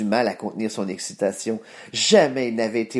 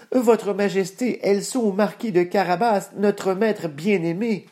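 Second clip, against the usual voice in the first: peasants answering together; 9 semitones higher than usual. Acted. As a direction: chant it like a village chorus. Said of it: "lui répondirent-ils tous en chœur".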